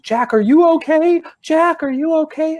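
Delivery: high, anxious calling to her little brother; worried big sister. Retold or imitated. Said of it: "she runs over to him and goes".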